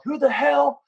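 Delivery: high-pitched mock rage about a stolen cat; mock angry yell. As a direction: play the furious old neighbour accusing him. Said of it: "starts yelling".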